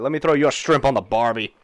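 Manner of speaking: loud hammed-up Aussie accent; Australian demon. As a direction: put on a cartoon Australian voice. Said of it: "like, an Australian demon".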